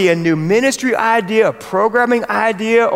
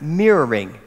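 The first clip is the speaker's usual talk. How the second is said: American accent; 6 semitones lower than usual. Shown as a demonstration. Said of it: "I don't say this word well at all. It's my American accent".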